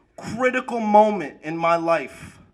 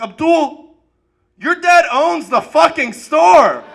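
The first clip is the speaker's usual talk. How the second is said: higher, louder, slow yell at a friend; exasperated yell; 7 semitones higher, 6 dB louder, slower than usual. Retold or imitated. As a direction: yell at him in disbelief. Yelling at classmate.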